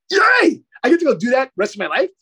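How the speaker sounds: put-on high, loud childlike cheer; elated, high-pitched retold whoop; joyful cheer. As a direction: burst out cheering in acted glee. Acts out joy at finding purpose.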